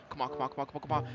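very quiet, low rapid repeats; hushed low pleading; muttered urging. Real, not acted. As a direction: urge yourself on under your breath. Urging on an attack.

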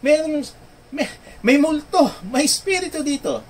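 high, loud panicked cry of ghost; frightened cry. Acted. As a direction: cry out in terror at a ghost on the water. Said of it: "cried out with fear".

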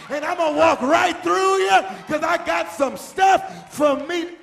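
high, driving preacher shout; full-force preaching. Real, not acted. Preacher telling the congregation to walk through critics, full-force preaching.

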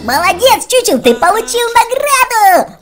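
ordinary delivery

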